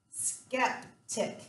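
"Skeptic" in two parts, a clear gap between; split into syllables. Shown as a demonstration. Teacher sounding out a word for spelling, "Skeptic" split in two syllables. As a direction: break "Skeptic" after "skep", each syllable crisp. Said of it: "two syllables".

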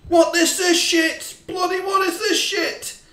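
loud, high outraged yell at the team; furious shout. Real, not acted. Manager yelling at his useless team, furious. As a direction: blast the players for the sloppy play.

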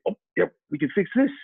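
mock a rep offering a fix too soon; imitating eager salespeople. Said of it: "tendency with other salespeople to also say".

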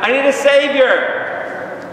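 high, drawn-out desperate cry; crying out for help. Acted. Preacher voicing a sinner's plea for a Savior, crying out. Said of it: "What am I crying out?".

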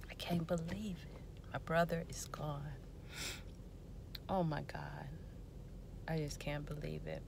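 soft, hushed disbelief, near whisper; real quiet grief. Real, not acted. Sister mourns brother on baby's birthday, grief-stricken. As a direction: let the voice sink with loss, pausing.

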